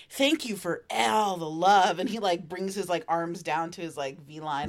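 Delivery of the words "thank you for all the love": slow, syrupy thank-you; drawn-out creepy thanks; mock slow thanks. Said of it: "he's like".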